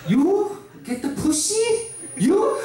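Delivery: high, slow mocking disbelief in a Korean mother's voice; incredulous mom. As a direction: scoff at him like his unbelieving mom.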